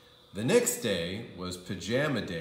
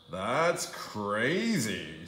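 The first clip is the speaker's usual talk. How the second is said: scoffing principal; much slower than usual. A narrator's reading.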